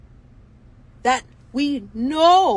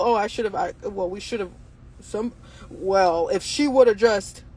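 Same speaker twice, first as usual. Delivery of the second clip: mock stammering excuse; 6 semitones lower than usual. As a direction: hem and haw like a guilty bystander. Mocking bystanders' stammered excuses.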